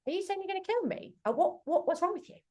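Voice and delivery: high, rushed alarm at a threat; alarmed challenge. Pretend. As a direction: confront him in disbelief. Imagined challenge to threatening partner.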